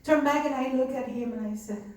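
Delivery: usual speaking voice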